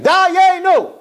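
loud, high-pitched yell; screamed chorus. Acted. Everyone at the Seder table answering together, high screamed cry. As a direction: belt it out like a whole table shouting. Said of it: "everyone's screaming".

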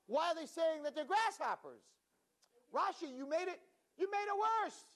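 high, exasperated complaint; mock exasperation. Rabbi complaining to Rashi, mock exasperated. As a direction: throw up your hands at Rashi.

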